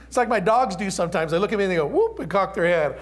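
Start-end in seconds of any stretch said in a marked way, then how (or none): 1.9-2.2 high squeaky dog yelp; puppy whoop. Retold or imitated. Dog noise voiced.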